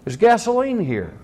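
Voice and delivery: high sharp warning yell. Retold or imitated. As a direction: shout him back from the gasoline. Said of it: "I yelled at that man".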